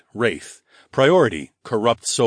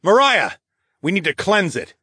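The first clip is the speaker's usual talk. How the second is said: raised, higher call; 9 semitones higher, 5 dB louder than usual. A narrator's reading. Hero summons Mariah behind him, calling out. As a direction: call it over your shoulder. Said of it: "called out over my shoulder".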